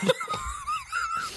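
big burst of laughter. Real, not acted. Laughing at the story.

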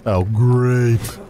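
drawn-out sarcastic approval; real sarcastic. Shown as a demonstration. Host reacting to restaurant news as coached, very sarcastic. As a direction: drag out GREAT with heavy sarcasm. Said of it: "sarcastic way".

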